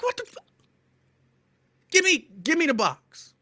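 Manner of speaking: high shocked outburst; exasperated. Retold or imitated. To the clerk demanding the box, high and exasperated. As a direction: cut him off in disbelief.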